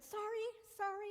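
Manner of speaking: apologizing to the woman on the trail; flustered apology. Retold or imitated. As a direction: blurt out a panicked apology. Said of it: "I immediately said".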